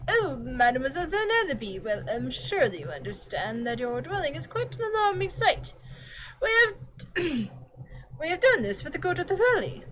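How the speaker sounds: fussy butler clock. Acted. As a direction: stammer politely while caught out. Voices fussy clock character.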